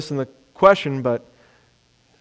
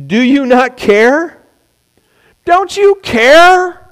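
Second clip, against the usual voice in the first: high, slow, pleading complaint, much higher; exasperated plea; 10 semitones higher, 6 dB louder, much slower than usual. Acted. Martha accusing Jesus of not caring, high drawn-out plea. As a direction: whine it out as if hurt and ignored.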